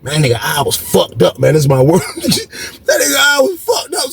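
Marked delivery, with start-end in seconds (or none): 2.1-4.1 high, amused retelling through laughter; laugh, then squeeze the words out higher. Real, not acted. Teller laughs at the injury.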